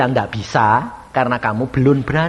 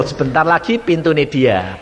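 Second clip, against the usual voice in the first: ordinary delivery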